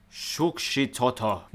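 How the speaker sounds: drawn-out, slow mock-Japanese pronunciation; Japanese-like accent. Real, not acted. Vlogger guesses a Salvadoran city's name, Japanese-like accent. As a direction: say it slowly as if it were Japanese. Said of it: "saying it like it's Japanese".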